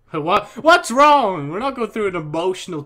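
loud, high-pitched hammed-up yell; mock-alarmed outburst. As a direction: burst out in exaggerated alarm, easing off at the end. Mock outburst at friend crying.

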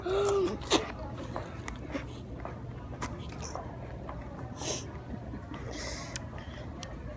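long unvoiced gasping breath; sharp gasp. Real, not acted. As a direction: gasp in at the cold. Cold drink challenge gasp.

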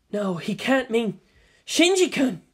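mildly alarmed, pitch rising on the name; dawning dread. Acted. Captive woman grasping the kidnapper's threat, alarmed.

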